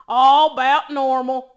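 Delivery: loud, slow country dad impression; daddy's deep country voice. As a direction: boom it slowly like a deep-voiced country father. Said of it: "talks that country and that deep".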